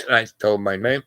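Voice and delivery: ordinary talk, nothing marked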